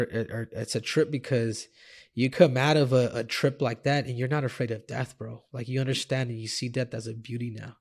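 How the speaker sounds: ordinary delivery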